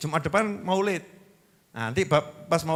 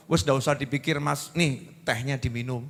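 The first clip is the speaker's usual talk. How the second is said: lower, softer, reassuring; gently calm a husband down, keeping it low; soothing wife; 6 semitones lower, 7 dB quieter than usual. Acted. Acts wife calming pressing husband.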